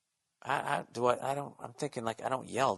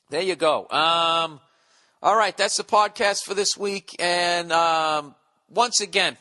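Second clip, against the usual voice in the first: ordinary talk, nothing marked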